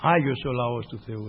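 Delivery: slow solemn preaching opening. Acted. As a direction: intone it like a preacher. Opens the sermon.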